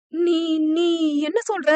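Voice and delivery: broken, stammering disbelief through tears. A narrator's reading. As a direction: choke out the question in shock, near crying. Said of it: "என திக்கி திணறி கண்களில் கண்ணீர் வழிந்தபடி கேட்க".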